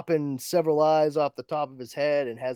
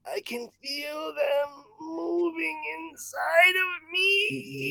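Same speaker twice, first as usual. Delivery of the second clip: much higher, slow, crying scream; horrified wail; slower than usual. Retold or imitated. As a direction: shriek it as he feels the spawn inside. Victim's horror, cry and shout.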